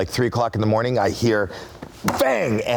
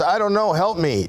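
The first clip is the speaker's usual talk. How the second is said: higher, lost plea for help; mild pleading; 8 semitones higher than usual. Retold or imitated. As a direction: sound dazed and hurt. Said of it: "She goes".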